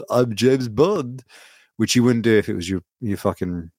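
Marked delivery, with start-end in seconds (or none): 0.1-1.2 goofy, drawn-out Bond introduction; mock James Bond. Mocking a spy who goes around introducing himself, mock James Bond. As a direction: announce yourself very slowly as a silly Bond. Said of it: "he goes around everywhere being like".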